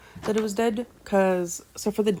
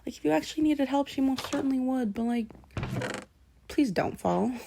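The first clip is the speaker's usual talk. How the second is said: much higher, quick reassuring inner voice; 7 semitones higher than usual. Retold or imitated. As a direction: talk to the boy in your head, pitched way up. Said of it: "in my head, I'm like".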